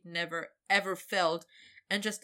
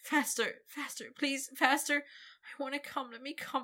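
high, breathless begging; desperate begging; 8 semitones higher than usual. Acted. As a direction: plead high and urgent.

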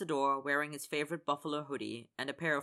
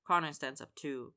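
plain reading voice

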